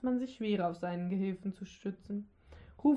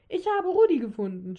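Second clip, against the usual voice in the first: bubbling over; 3 semitones higher, 7 dB louder than usual. A narrator's reading. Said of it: "sprudelte es aus Zwolgo heraus".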